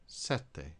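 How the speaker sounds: Sette with held double t, SET-te; Italian double t geminated; Italian double consonant. Shown as a demonstration. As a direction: hold the tt in Sette. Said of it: "one of the consonants at the end".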